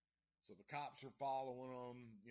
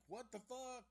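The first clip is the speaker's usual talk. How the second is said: high, startled yelp of disbelief; a mock freaked-out shriek; shocked outburst; 11 semitones higher than usual. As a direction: squeak it out in sudden shock. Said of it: "so they're like".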